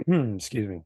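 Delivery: ordinary talk, nothing marked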